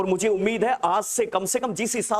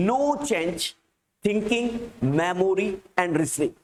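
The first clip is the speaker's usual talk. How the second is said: word groups parted by pauses; halting, measured classroom dictation; slow dictation; much slower than usual. Real, not acted. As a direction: dictate in small chunks, leaving time to write. Repeats dictated sentence once more.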